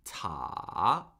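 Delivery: "ta" dipping low then rising; third tone. Shown as a demonstration. Teacher modelling tones for repeating, "ta" falling then rising. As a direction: start "ta" low, fall, then swing back up. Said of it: "it falls and then rises".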